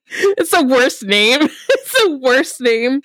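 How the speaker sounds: laughing complaint. Real, not acted. Laughing complaint about a name.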